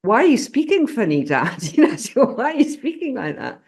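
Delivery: put-on high-pitched child questioning a parent; puzzled child. Daughter asks dad about his dialect, high puzzled child voice. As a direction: ask it like a baffled little kid.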